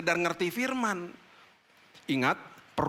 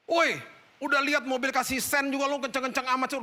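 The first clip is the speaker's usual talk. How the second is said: high-pitched scolding yell at the rider; mock a crowd of angry motorcyclists shouting; angry bystanders yelling; 10 semitones higher than usual. Retelling how motorcyclists yelled at the sleepy rider, angry scolding. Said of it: "motoris-motoris yang udah datang pada marah-marahin dia".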